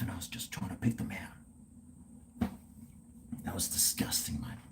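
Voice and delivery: hushed whisper. Real, not acted. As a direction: whisper it in shame. Confessing a grim memory.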